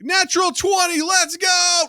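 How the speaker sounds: high shouted cheer; excited cheer. Retold or imitated. Dad cheers his daughter's dice roll, high excited shout. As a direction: yell it like you rolled a nat twenty.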